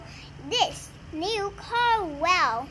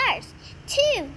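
plain reading voice

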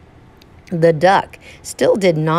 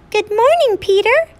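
high, bright chirping bird voice; cheerful chirpy bird. Acted. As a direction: chirp it like a happy little bird. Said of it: "chirped the bird gaily".

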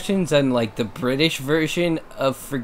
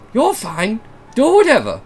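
higher British read, not deeper; weird British accent; 8 semitones higher, 6 dB louder than usual. Acted. Shane's line again, oddly pitched British. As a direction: try deeper but it comes out odd. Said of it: "didn't come out deeper".

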